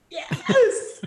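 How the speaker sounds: high, loud, starstruck yes; thrilled yes. Retold or imitated. Young singer asked if she knows [name], high thrilled yes. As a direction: squeal it out with wonder.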